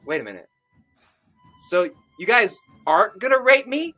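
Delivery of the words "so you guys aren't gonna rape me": high, raised-voice question; calling out, yelled. Acted. Sam calls after departing eunuchs, yelled question. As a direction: yell the question, rising high at the end. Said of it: "I yelled as I jumped up".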